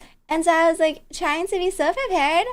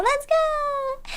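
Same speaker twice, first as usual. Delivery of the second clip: high, bright let's go; hyped cheer; 12 semitones higher than usual. Real, not acted. Streamer cheering viewers into recap time. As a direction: cheer it like a kickoff.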